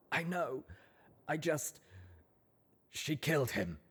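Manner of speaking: pushing through rising tears until it breaks; breaking into sobs. Acted. Colt confessing to Rampage, breaking into a sob. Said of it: "he broke off in a shaking sob".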